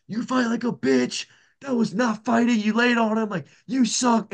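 high-pitched imitation of a screaming heckler; mock heckler yell. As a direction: imitate a heckler yelling across the cage, without full volume. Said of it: "dude across the cage screaming".

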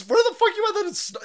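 high, loud, fast disbelieving yell; incredulous yell. Real, not acted. Outraged at snow in June.

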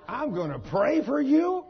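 loud, high, slow disbelief, rising at the end; incredulous question. Retold or imitated. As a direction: echo your own words in comic disbelief. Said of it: "Where did that come from?".

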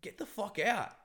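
high, accusing outburst; snapping angrily. Retold or imitated. Man telling the manipulative woman to leave, angry. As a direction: retell a heated accusation ending in an order. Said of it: "I just snapped out of it".

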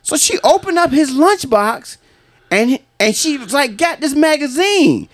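excited, very high-pitched storyteller voice; coworker retelling a row. Retold or imitated. Coworker recounting his wife's magazine find, high-pitched indignant retelling.

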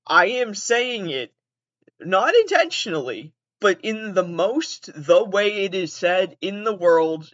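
exasperated, stretched-out retort; slow, emphatic insistence. Retold or imitated. Caller defends pronunciation to trivia players, slow and emphatic.

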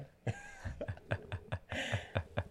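real amused laughter; hearty laugh. Real, not acted. Storyteller laughing at his wife's reply, amused. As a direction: burst out laughing at your own story.

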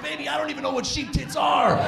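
high, shouted protest; defensive shout. Retold or imitated. Boyfriend protesting to his girlfriend, high defensive shout. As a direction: yell your innocence up high. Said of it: "I was like".

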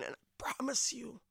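a hushed whispered vow; whispered promise. Acted. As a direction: breathe the promise like a secret. God's tender promise in poem.

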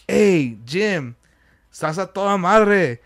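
imitated Spanish-inflected homeboy greeting; weird Spanish speaking. Starstruck fan greeting his idol Jim, Spanish-inflected. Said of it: "weird Spanish speaking".

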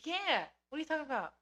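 as if it's obvious; incredulous. Real, not acted. Incredulous reply, much higher.

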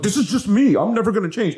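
lightly mocking a stubborn lover. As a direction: sound like a stubborn partner refusing change. Quoting stubborn partners.

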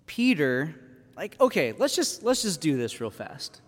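usual speaking voice

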